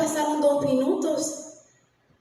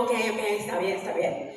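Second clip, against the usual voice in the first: low, soft, giving in; meek acceptance; 6 semitones lower, 6 dB quieter than usual. Retold or imitated. Daughter retelling how she answered her father, meek and quiet. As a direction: drop the voice and meekly agree.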